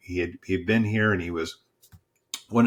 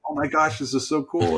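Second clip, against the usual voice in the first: delighted; 7 semitones higher than usual. Retold or imitated. Pastor delighted the group is watching.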